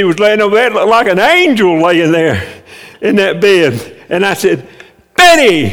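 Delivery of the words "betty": loud, high call of her name. Retold or imitated. Husband wakes his sleeping wife with a loud call.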